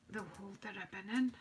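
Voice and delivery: ordinary talk, nothing marked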